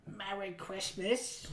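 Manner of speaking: very soft, slow character voice; quiet comedian impression. Friend testing his voice quietly, very soft. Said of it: "I'm trying to be quiet, but, like, do his voice".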